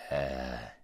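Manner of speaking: low, slow, huge voice of a great lion; large deep voice. A narrator's reading. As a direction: speak low and vast, unhurried. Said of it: "it said".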